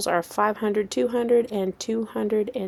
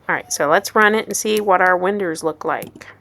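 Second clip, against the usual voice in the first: ordinary talk, nothing marked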